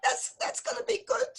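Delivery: worried Yiddish mama, building to cries; yiddish accent. Acted.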